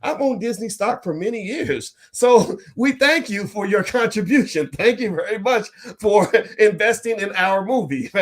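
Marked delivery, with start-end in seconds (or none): 2.1-8.1 high-pitched, laughing mock gratitude; gloating mock thanks. Disney stockholder thanking moviegoers for contributing, laughing and teasing. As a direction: thank them while cracking up.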